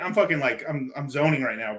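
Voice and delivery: normal talking voice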